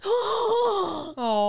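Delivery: big imitated gasp, high "oh"; acted-out gasp of surprise; mom's shocked gasp. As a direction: gasp like an overwhelmed mom. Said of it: "and she goes".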